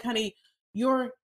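normal talking voice

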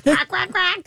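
duck quack. Shown as a demonstration. Duck sound for kids.

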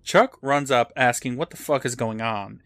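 ordinary delivery

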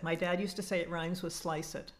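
ordinary talk

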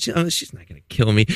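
normal talking voice

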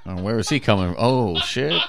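ordinary talk, nothing marked

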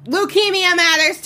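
high-pitched heckler's outburst; mock indignant shout. Mock interrupter at cancer event.

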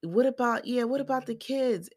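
high-pitched mimicking echo; Robin. Recapper mimics co-wife joining in about kids, high mocking echo. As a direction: chime in high, parroting the question. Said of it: "and here go Robin".

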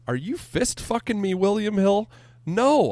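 high, incredulous outrage; outraged. Real, not acted. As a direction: rant in disbelief. Outraged at gambling site demands.

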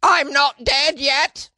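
high, slow British falsetto quote; Monty Python accent. Retold or imitated. Podcaster quoting Holy Grail in a Monty Python accent. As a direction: croak it out like the Holy Grail plague victim. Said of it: "It's like the Monty Python accent".

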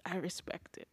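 hushed, whispered aside. Retold or imitated. Host repeats their reaction to the artistic attempt, whispering. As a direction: drop it to a bare whisper.

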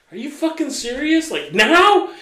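high-pitched incredulous question; acting out stunned, high-pitched objection; retold disbelief. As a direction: voice shoots up in disbelief. Retold disbelief at doctor's plan.

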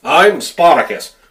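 loud, slow heroic declaration; bad Tony Randall impression. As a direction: proclaim it like an epic hero. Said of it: "doesn't sound like Tony Randall at all".

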